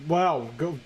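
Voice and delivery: normal talking voice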